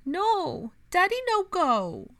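put-on high, loud, slow child's wail; a little boy begging his daddy not to leave; toddler protesting. A narrator's reading. Toddler protesting his father leaving.

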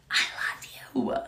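breathy hushed I love you; whispered. Acted. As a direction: drop to a whisper to God. Repeated declaration, then whispered.

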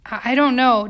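embarrassed. A narrator's reading. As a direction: stumble over the start, embarrassed. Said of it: "strangely embarrassed to have sparked such excitement".

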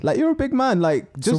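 exasperated, high-pitched retold rebuke; incredulous scolding. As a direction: replay your disbelief at him, voice shooting up. Retold scolding of sulking driver.

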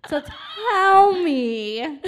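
drawn out. Real, not acted. Birthday clash complaint.

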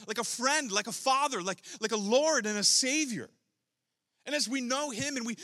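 rising into full preaching force; building preaching. Acted.

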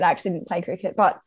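normal talking voice

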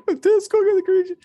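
mimicking a friend. Retelling lactose-intolerant friend.

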